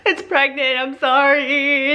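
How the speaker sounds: high, tearful mock wail; imitated bawling. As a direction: act out sobbing an apology, dragging out the last words. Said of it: "started bawling, like, hardcore".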